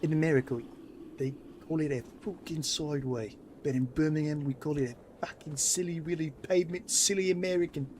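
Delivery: mock a Brummie sneering at Americans; Birmingham accent. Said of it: "In my big Birmingham accent".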